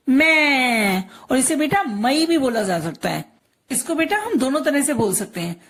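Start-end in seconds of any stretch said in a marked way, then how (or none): 0.1-1.0 मैं held long on one open vowel; drawn-out syllable. Shown as a demonstration. Teacher answering for the child, drawn-out मैं. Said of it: "इसे हम क्या बोलेंगे?".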